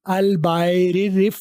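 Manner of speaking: I'll buy it with the t of it turned into a quick flap, buy-rit. Shown as a demonstration. Teacher slowly showing the flapped t in I'll buy it. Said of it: "इट वाला टी आर में कर रहा हूँ".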